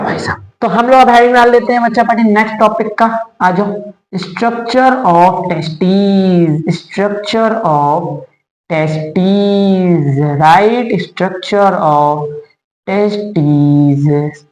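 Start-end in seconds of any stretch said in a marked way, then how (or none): 4.2-10.2 very slow, stretched syllables; saying a heading slowly while writing; drawn out. Real, not acted. 11.0-14.4 very slow, low ending on a long last word; slow dictation of a heading; drawn out. Real, not acted. Saying heading while writing it out.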